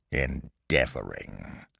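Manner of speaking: low, slow menacing repeat; fierce. A narrator's reading. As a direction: draw the word out softly with contained menace. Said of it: "cried the tyrant fiercely".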